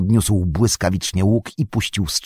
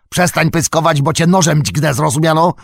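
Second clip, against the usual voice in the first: higher-pitched, aggressive warning; threatening order; 5 semitones higher than usual. Acted. Orc warrior threatening the mouthy tracker with a knife, angrily. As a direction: demand obedience with a menacing question.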